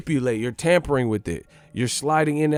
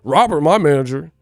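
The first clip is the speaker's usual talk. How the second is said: indignant denial; 4 dB louder than usual. Retold or imitated. Boyfriend insisting Robert is his manager, building indignant denial. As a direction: protest harder and harder.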